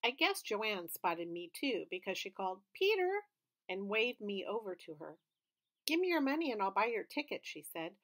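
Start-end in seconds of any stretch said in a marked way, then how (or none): 2.7-3.3 high call across a crowd; much higher, louder call. A narrator's reading. Joanne calling across line. 5.9-7.5 fast, higher and eager; fast friendly offer; quick offer. A narrator's reading.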